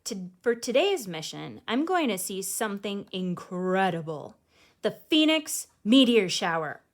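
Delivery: put-on lively read-aloud voice for children; storybook reading. A narrator's reading. Host reading a picture book to kids, lively storytelling. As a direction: read it like bedtime story time.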